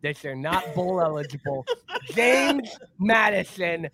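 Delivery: slow, emphatic put-on coach voice; Lou Holtz. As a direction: grandly praise the team in a comic coach's voice. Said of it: "that Lou Holtz praising James Madison".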